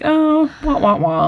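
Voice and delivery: high start sliding down, wah-wah; mock sad trombone. Podcast guest capping a dark joke, mock sad trombone. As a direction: imitate a comic falling trombone.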